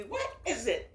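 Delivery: ordinary talk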